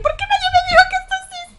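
very high, loud, fast wail; outraged disbelief. Retold or imitated. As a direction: cry out in mock despair. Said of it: "Y yo".

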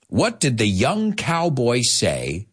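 slow, drawn-out riddle; slow joke setup. Shown as a demonstration. A teacher slowly sets up a cowboy joke for students. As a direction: let each word land. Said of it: "I prepared two for you".

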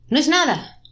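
high, loud, sudden shout. A narrator's reading. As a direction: shout it out, pitch jumping up. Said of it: "digo gritando". (gritando, con voz alta y aguda)

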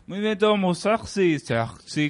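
mock sensual singer. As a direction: play the cheesy sexy lover. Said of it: "Es un gangoso sensual".

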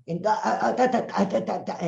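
a mock stammer, stuck and repeating; imitated stutter. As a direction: mimic someone stuck on a syllable. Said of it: "dónde hay tartamudez".

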